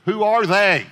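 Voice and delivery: loud, high-pitched challenge; exasperated demand. Acted. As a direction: burst out with the question. Exasperated question after quoting people.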